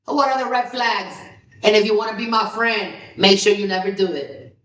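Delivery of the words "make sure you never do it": ordinary delivery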